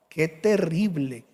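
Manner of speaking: ordinary talk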